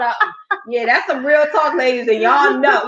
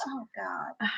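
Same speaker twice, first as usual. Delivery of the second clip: breathy whisper under her breath; quiet exasperated whisper; whispered aside; 3 semitones lower, 19 dB quieter than usual. Real, not acted. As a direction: drop to a whisper.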